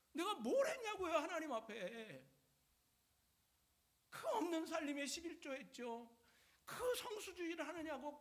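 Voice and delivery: high, plaintive acted protest to God; resentful complaint. Job pleading innocence before God, resentful wailing complaint. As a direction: whine and protest, peaking in an exasperated outburst. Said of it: "하나님 앞에 막 원망이 있는".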